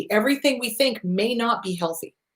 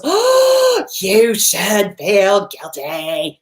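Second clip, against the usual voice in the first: guilt voice; much slower than usual. Retold or imitated. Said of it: "When that voice pops up and goes".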